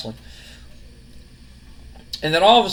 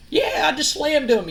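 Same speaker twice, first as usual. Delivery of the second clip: much higher, cheery imitated Kurt; gleeful gloat; 10 semitones higher than usual. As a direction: crow about it happily. Kurt Angle gloating happily.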